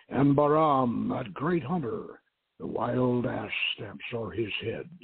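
plain reading voice

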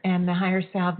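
normal talking voice

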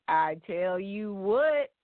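slow, drawn-out country drawl; country accent. Shown as a demonstration. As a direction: stretch it like a country saying. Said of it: "in your best country accent".

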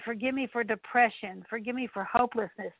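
ordinary talk, nothing marked

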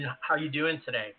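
ordinary delivery

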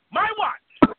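"My" pitched high and punched loud; "My" shouted high, "watch" falling. Acted. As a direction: top the last take, "My watch." near-shouted. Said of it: "repeated louder".

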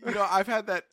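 usual speaking voice

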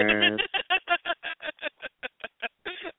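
a burst of high, delighted laughter. Real, not acted. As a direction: laugh out, thrilled and flattered. Host laughs after caller praise.

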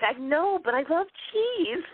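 high-pitched helpless protest, played for laughs; mock pleading. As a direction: plead your weakness, voice climbing high. Acted pleading reply to friends.

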